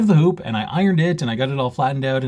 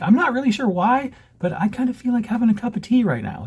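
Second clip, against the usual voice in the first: high-pitched mock inner voice; imagined puzzled viewer; 11 semitones higher than usual. Host voicing what viewers will think, puzzled high voice. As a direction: voice a viewer's thought, pitched well up.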